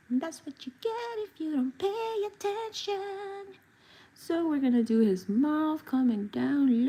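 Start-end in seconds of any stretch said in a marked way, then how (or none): 0.1-3.6 high squeaky cartoon duck voice, slow. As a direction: squeak it out like a silly street duck. Voicing the duck character.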